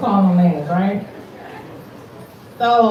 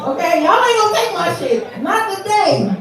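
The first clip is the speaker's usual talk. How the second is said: defiant; 9 semitones higher than usual. Real, not acted. Host defiant to crowd.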